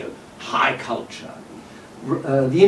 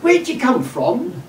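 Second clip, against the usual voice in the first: mock bright, helpful kid voice, higher and slow; cheery shop assistant; 9 semitones higher, slower than usual. Young clerk greets old émigré customer, higher, slow and cheery. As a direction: sing it out like an eager young clerk. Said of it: "trying to be helpful and friendly".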